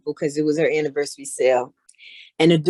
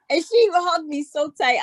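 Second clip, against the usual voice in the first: ordinary delivery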